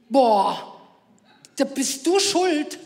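drawn-out, loud mock teenage whine; whining teen son. Son whining at mum after wrong answer, mock whine. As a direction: play the sulky teen blaming mum.

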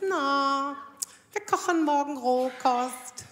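slow, cheery mock announcement; a hint of a smug girlfriend; lightly voiced girlfriend. Sven's girlfriend announcing raw food, slow mock cheer.

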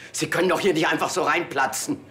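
sharp, loud telling-off; angry scolding. Acted. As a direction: scold him for barging in. Scolding man who barged in.

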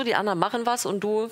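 normal talking voice

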